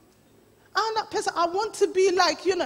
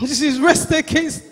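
ordinary talk

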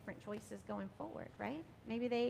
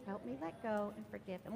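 softer, gentle repeat of the plea; hushed second take of the plea; softer prayer echo; 4 semitones lower, 6 dB quieter than usual. Shown as a demonstration. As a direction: let it fall away quietly. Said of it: "and say".

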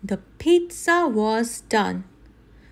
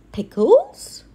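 surprised; 6 semitones higher, 6 dB louder than usual. Acted. Gang puzzled by new topping.

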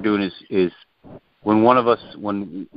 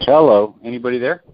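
louder, higher questioning hello; real call-out, raised and rising; 5 semitones higher, 5 dB louder than usual. Real, not acted. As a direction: call into a silent line for an answer. Newcomer checking the open line.

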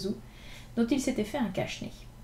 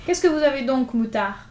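calling out; 3 semitones higher, 7 dB louder than usual. Acted. Gavroche calling after two crying boys, loud and cheeky. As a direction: hail them across the street.

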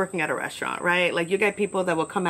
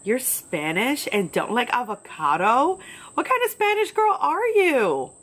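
hammed-up disbelief, climbing much higher; mock incredulous; 5 semitones higher, slower than usual. Customer judging her over avocado, mock incredulous. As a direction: imitate an appalled, judging customer.

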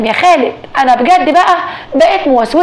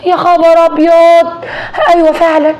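aghast; 5 semitones higher than usual. Acted. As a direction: stretch each word out in horror. Wife sees the mummified dog.

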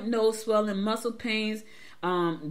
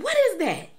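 much higher and louder, disbelieving; can't believe what she's hearing; shocked question; 6 dB louder than usual. Retold or imitated. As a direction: throw it up high in disbelief. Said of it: "I'm like".

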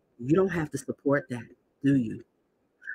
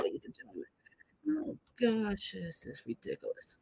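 breathy whisper to the audience; muttered aside to viewers, whispered; 3 semitones higher, 15 dB quieter than usual. Real, not acted. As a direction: hush it, almost unvoiced.

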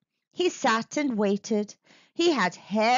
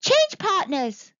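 high, loud monkey call; 10 semitones higher, 5 dB louder than usual. A narrator's reading. Monkey directing the monster's dance, calling out. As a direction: call the dance change loudly. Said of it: "called Monkey".

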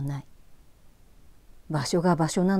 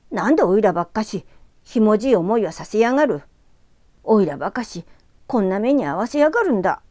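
put-on scornful angry boy; 4 semitones higher, 6 dB louder than usual. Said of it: "馬鹿にしたように鼻を鳴らした".

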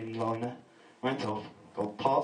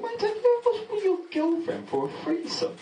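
cheeky question; 4 dB louder than usual. Retold or imitated. Asking colleague cheekily.

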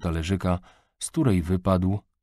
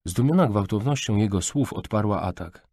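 ordinary delivery

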